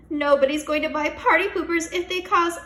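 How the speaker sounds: high, loud shout; teacher shouting at the screen; alarmed shout. Acted. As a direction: shout from the computer. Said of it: "shouted Mister Cooper".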